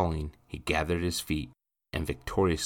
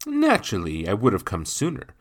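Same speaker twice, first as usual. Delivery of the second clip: louder, lilting show of magnanimity; formal, raised-voice gentleman; officious gentleman; 6 dB louder than usual. Acted. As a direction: make a grand show of concern.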